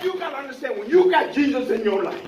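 loud, rising preacher's cadence with hallelujahs; full-force sermon delivery; fervent preaching. Acted. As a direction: preach it out to the congregation.

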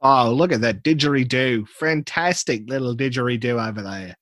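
broad comic Australian; Australian accent. Acted. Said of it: "Straight in with the stereotype".

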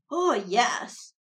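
a creature delighted by the offer; happily. A narrator's reading. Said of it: "the ghoul said happily".

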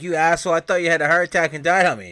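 loud, high, rushed scolding; mock outrage. Woman scolds the man she thought had died, outraged. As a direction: act out an angry telling-off. Acted scolding after fake death scare.

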